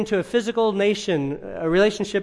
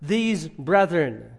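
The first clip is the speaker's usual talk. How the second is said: normal talking voice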